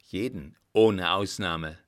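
firm defiance. A narrator's reading. Defiant doctor threatens the emperor.

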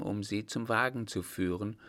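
plain reading voice

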